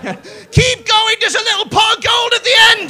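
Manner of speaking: high, loud, fast heckle; crowd shout. Real, not acted. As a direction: yell it up from the audience.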